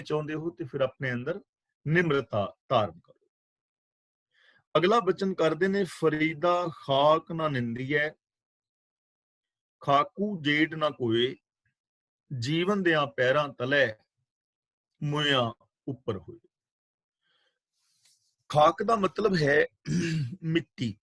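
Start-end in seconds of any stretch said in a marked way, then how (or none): 5.8-16.4 slow, measured recital of scripture; slow verse recitation. Acted. Preacher reciting Farid's verse to the congregation, slow and reverent. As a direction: intone each phrase slowly and reverently.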